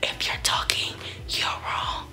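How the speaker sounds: whisper. Retold or imitated. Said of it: "But it'd be like a whisper".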